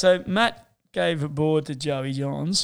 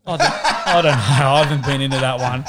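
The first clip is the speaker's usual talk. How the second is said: laughing; 7 dB louder, faster than usual. Real, not acted. Laughs off flat-earth question.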